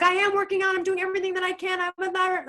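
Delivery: exasperated high defensive cry; desperate pleading. Retold or imitated. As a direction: plead your case, nearly begging to be believed. Pleading with people who judge her.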